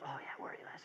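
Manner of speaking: hushed voice of the whole table agreeing; very soft, low murmur of agreement. Retold or imitated. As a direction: nod along under your breath.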